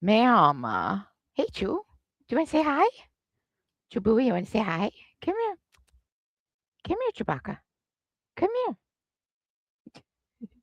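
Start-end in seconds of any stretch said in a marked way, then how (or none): 1.3-10.5 high pet talk. Real, not acted. Calling the dog Chewbacca over in high baby talk.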